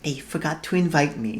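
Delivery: a hint of a sweet small boy; lightly voiced innocent child; sweet little voice. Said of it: "Twinkle's sweet little voice replied".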